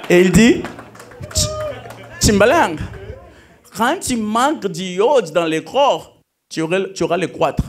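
put-on a school friend explaining biology in a thick Luba accent. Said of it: "Avec son accent louba".

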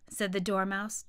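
plain reading voice

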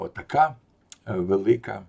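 plain reading voice

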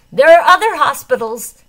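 high, loud near-shout; almost shouting. A narrator's reading. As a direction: nearly yell it in desperation. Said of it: "he says, almost shouting".

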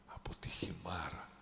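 whispered, breathy; hushed repetition. Real, not acted. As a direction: drop to a whisper for the place name. Emphatic hushed repetition of place. (ψιθυριστά)

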